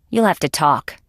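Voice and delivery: normal talking voice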